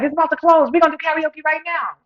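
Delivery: high, loud insistent cousin. Retold or imitated. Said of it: "She's like".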